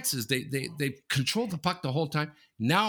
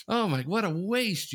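sulky senior; 9 semitones higher than usual. Retold or imitated. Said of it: "they walk away sulking".

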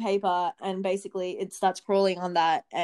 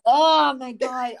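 long, drawn-out, louder squeamish groan; grossed out; 4 semitones higher, 6 dB louder, much slower than usual. Real, not acted. Speaker reminded of the crunch of killed insects, grossed out. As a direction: recoil slowly and loudly in disgust.

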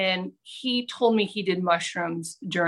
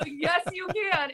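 high insistent repeat, "can" lifted; emphatic rebuttal; 8 semitones higher, 4 dB quieter, slower than usual. Real, not acted. Storyteller rebutting friend amid laughter, emphatic. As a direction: insist on it, pitch climbing on "can".

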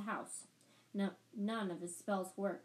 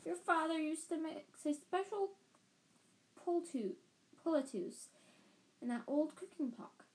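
put-on higher old woman's voice; old woman pleading; 7 semitones higher than usual. A narrator's reading. Peasant woman reminding the wizard of his father, higher voice. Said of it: "she told him".

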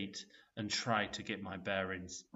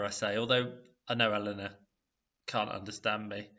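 plain reading voice

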